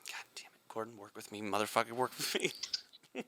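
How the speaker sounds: hushed mock frustration; whispered pleading aside. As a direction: hiss under your breath for cooperation. Mock aside to uncooperative guest.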